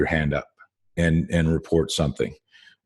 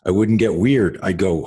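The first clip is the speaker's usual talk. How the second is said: normal talking voice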